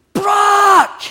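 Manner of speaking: high, full-force scream of a name; top-of-lungs scream. Retold or imitated. Dad in a state of panic calls his son, screaming. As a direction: scream it with everything you have. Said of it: "scream at the top of my lungs".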